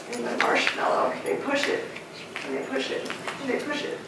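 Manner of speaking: almost whispered, tense; soft voice building suspense; hushed. Retold or imitated. Kid pushing marshmallow away.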